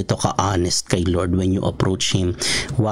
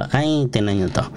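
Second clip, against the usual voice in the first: ordinary talk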